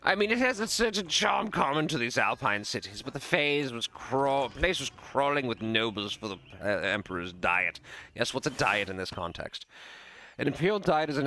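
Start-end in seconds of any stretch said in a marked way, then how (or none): 0.0-7.8 worldly nobleman airing his opinions; lightly voiced travelling lord.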